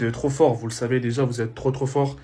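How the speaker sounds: usual speaking voice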